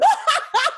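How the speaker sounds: high, loud re-enacted corpsing laugh; burst of laughter. Actress showing how she corpsed downstage, mock burst of laughter. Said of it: "burst out laughing. I just went".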